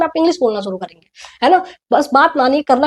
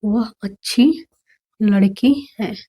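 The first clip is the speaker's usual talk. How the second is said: slow, lower, as if writing it down; 3 semitones lower, much slower than usual. Shown as a demonstration. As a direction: mouth it while writing. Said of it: "Hindi लिख के मैं समझाने की कोशिश कर रही हूँ". (लिखते हुए धीमे से बोलना)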